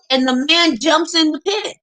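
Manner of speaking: ordinary talk, nothing marked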